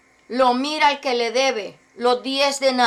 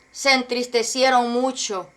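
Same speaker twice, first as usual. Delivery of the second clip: normal talking voice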